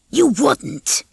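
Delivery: plain reading voice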